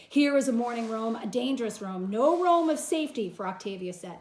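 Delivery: loud mournful declamation; dramatic reading. Acted. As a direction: warn the servant gravely. Teacher reads Antony's lines.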